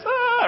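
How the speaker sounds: put-on high wailing ah like a frightened baby; imitated baby wail. Pastor acting out his baby daughter's cry on the stairs, high wail. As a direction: mimic the little girl's scared cry. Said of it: "like this".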